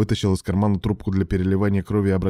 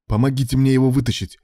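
raised, loud, resolute order; decisive; 5 semitones higher, 5 dB louder than usual. A narrator's reading. Ilya ordering rescuers to help free Ruslan, decisive. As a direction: take command firmly. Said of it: "решительно произнёс Илья".

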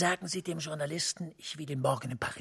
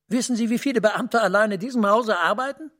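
louder, pressing the rhetorical question; raised exasperation; 4 semitones higher, 8 dB louder than usual. Acted. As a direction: push back hard on the idea. Official stressing the risk.

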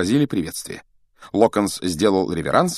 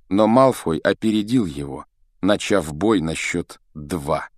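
ordinary delivery, nothing marked

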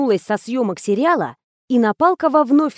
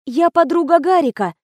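high, meek explanation; lightly flustered, pitched up; babbling timidly; 7 semitones higher than usual. A narrator's reading. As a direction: explain yourself meekly to a cross old man. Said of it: "залепетала актриса".

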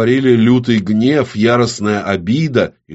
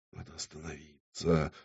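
very soft, low groan to oneself; inward moan; 5 semitones lower, 17 dB quieter than usual. A narrator's reading. Ksana moans inwardly that she must stop, hushed. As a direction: barely voice it, a hushed inner plea. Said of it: "простонала про себя Ксана".

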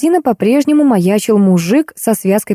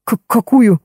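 stammering; 2 semitones higher than usual. A narrator's reading. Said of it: "разаикался покупатель".

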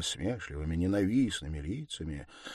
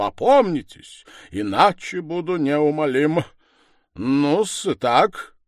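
raised voice, pointed pauses; stern, insistent accuser; 5 semitones higher, 10 dB louder than usual. Acted. Accuser urging Sonya to think again, stern and insistent. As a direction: press for a confession, higher and louder.

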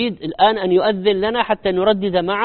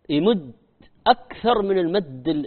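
ordinary delivery

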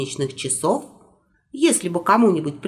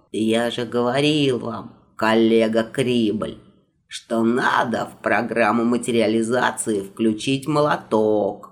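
put-on old magister voice; 8 semitones lower than usual. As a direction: lecture like a fussy old wizard.

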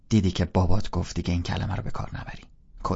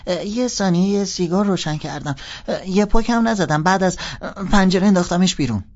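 put-on high, bright-eyed kid sister confessing; little girl voice; 11 semitones higher, 9 dB louder than usual. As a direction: play the young sister, pitched well up. (صدای دختر بچه، زیر و بلند)

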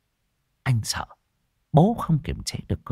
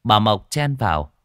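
ordinary delivery

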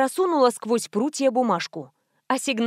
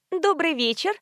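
higher-pitched, friendly greeting; smiling; 7 semitones higher than usual. A narrator's reading. Nurse greeting a visitor, smiling. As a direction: greet them with an audible smile. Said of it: "улыбнулась в ответ медсестра".

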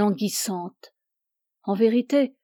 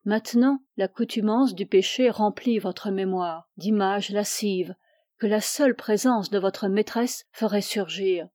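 plain reading voice

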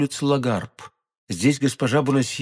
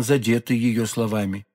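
ordinary delivery, nothing marked

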